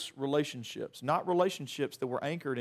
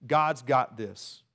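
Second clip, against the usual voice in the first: slow quoted phrase; 4 semitones lower, much slower than usual. Shown as a demonstration. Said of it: "We say this phrase".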